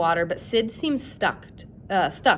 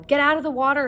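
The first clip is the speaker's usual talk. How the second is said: scream; 6 semitones higher, faster than usual. A narrator's reading. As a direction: yell it from the hill. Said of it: "he screamed".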